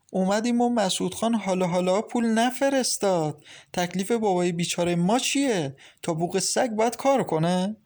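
heated sister. Acted. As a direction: defend your poor father, fired up. Samira arguing over her father. (اعتراض تند و زیرتر دختر)

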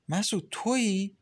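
light up at hearing his voice; happy. A narrator's reading. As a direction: Maryam overjoyed on the phone with Masoud. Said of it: "مریم با خوشحالی گفت".

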